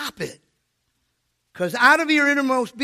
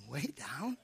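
very soft, low questioning WAY DOWN, rising at the end; hushed incredulous repeat of "Way down?"; puzzled inner echo; 8 semitones lower, 16 dB quieter than usual. Retold or imitated. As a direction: murmur "Way down?" to yourself in doubt. Puzzled inward echo of the answer.

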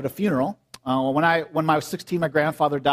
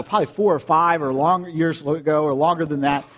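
ordinary talk

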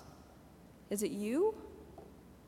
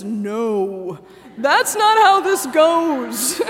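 louder, slow firm no from Naomi; mock stern Naomi; 12 dB louder, much slower than usual. Naomi to the lovesick student, a loud slow firm no. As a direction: put your foot down, loud and slow. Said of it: "Naomi says".

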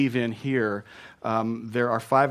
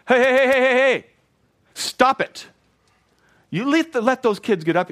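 sharp repeated "hey", much higher and louder; furious shout; 10 semitones higher, 6 dB louder than usual. Retold or imitated. Jesus rebuking his disciples, a furious shout. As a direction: stop them cold, outraged. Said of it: "Jesus is furious. He says".

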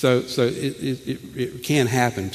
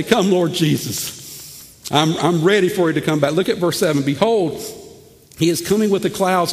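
fervent scripture reading; 6 semitones higher than usual. Acted. Preacher reading Revelation to congregation, higher and fervent. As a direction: proclaim the verse with fire.

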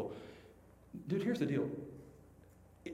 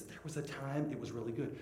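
soft, lower imitated reminiscing; hushed, lowered voice; wistful recollection; 4 semitones lower, 10 dB quieter than usual. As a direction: play mom quietly looking back. Mom reminiscing over old pictures.

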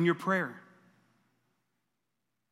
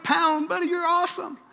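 delighted praise; 11 semitones higher than usual. Real, not acted.

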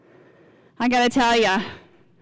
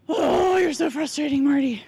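acted exasperation; 3 semitones higher than usual. Wife scolding her husband over printer ink, acted exasperation. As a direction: let the exasperation spill out, hammed-up. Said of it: "sometimes he frustrates me".

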